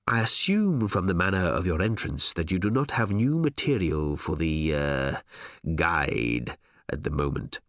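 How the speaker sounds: low, measured executive drawing out the end; smooth-faced boss. Acted. Smooth-faced editor speaks.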